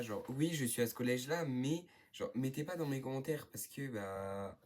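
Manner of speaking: ordinary talk, nothing marked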